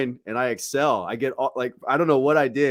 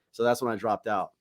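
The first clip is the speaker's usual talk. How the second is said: ordinary talk, nothing marked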